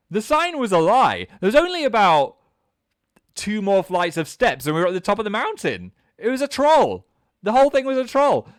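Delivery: high, loud mock outrage; indignant outburst. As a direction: cry out as if you've been tricked. Outraged punchline about the false sign.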